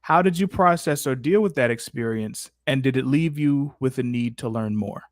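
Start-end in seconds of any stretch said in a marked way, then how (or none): none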